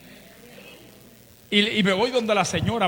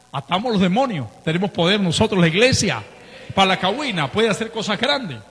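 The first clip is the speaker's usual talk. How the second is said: preaching climax; 3 semitones higher, 6 dB louder than usual. Acted.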